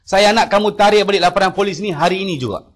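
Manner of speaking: imitating the boss shouting an order; angry order. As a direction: order him to withdraw it today. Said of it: "Dia bagi arah kat saya".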